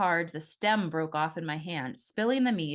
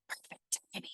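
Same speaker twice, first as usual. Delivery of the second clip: soft unvoiced breathy whisper; 13 dB quieter than usual. A narrator's reading. Bartender whispering a quip to the newcomer at the bar. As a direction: whisper it so only she hears. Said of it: "the bartender whispered".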